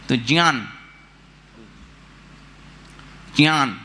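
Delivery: ज्ञान said with a j-plus-ny sound; South Indian pronunciation. Shown as a demonstration. Teacher models ज्ञान for the class as "jnyaan", demonstrated pronunciation. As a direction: say ज्ञान as "jnyaan", not "gyaan". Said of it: "वो भी ज्ञान बोलते हैं".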